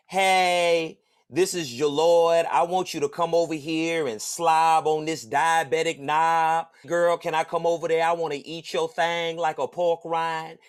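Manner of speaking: slow, loud, hammed-up sleazy read; mock-sleazy reading, slow and loud; mock lewd text. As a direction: lightly ham up a crude come-on. Ex's fake text read aloud.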